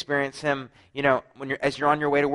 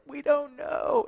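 high, giggling "we don't know"; giggly; much slower than usual. Retold or imitated. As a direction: squeak it out through suppressed laughter. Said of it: "We're like".

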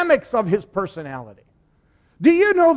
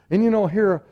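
ordinary talk

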